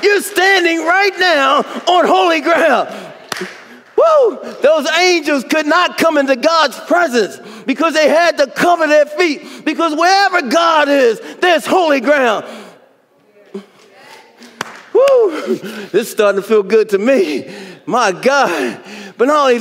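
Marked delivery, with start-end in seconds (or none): 4.0-4.4 high excited whoop, real; preacher's ecstatic whoop; joyful high whoop. Real, not acted. 14.9-15.5 high excited whoop, real; preacher's ecstatic whoop; joyful high whoop. Real, not acted.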